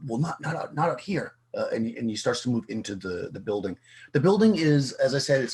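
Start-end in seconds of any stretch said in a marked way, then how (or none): 0.1-1.3 higher-pitched, jittery stammer; uneasy dealer tripping over his words; nervous stutter. Acted. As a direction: stutter a hurried refusal, repeating words. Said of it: "he looks a bit nervous".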